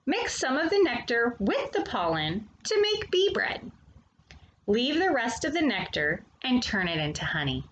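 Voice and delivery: lively, sing-song picture book reading; reading to children. Acted. Storyteller reading a bee picture book to young children, animated and sing-song. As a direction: read it to the little ones like a storyteller.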